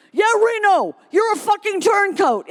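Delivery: high, loud shout; angry interruption. Acted. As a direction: shout her down. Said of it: "interrupted a woman".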